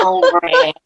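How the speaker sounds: high, laughing Southern drawl; Southern accent. Shown as a demonstration. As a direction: play up the Southern accent for fun. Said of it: "Say it with your Southern accent".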